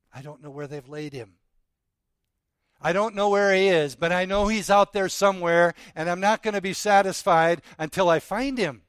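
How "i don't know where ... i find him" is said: determined cry. Acted. Mary, searching for her Lord, cries out with loud determination. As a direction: voice Mary's resolve, loud and rising.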